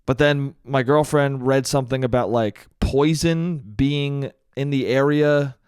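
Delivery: normal talking voice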